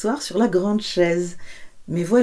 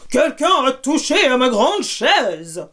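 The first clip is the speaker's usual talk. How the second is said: big voice; 5 semitones higher than usual. Acted. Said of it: "cria d'une grande voix".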